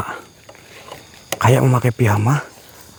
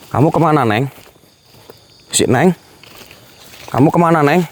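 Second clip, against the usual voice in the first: raised, higher searching call; calling after someone; 6 semitones higher than usual. Real, not acted. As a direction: call out to find her. Calling after vanished woman. (memanggil mencari dengan nada tinggi)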